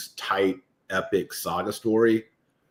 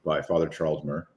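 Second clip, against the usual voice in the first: normal talking voice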